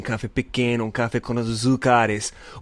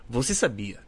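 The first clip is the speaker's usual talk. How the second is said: high, bright fact-reading voice; announcer voice; 6 semitones higher than usual. Acted. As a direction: read it like a trivia announcer. Reads facts in another voice.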